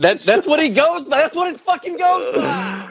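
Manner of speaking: high-pitched emphatic insistence; insisting. Real, not acted. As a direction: insist it's really his name. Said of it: "insisting on the nickname".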